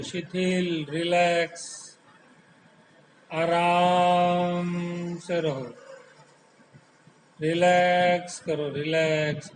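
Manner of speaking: slow meditation voice with long pauses; hypnotic, stretched-out vowels on the calming words; guided relaxation. Real, not acted. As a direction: draw out each soothing word, lulling the body to let go. Leads a guided body relaxation.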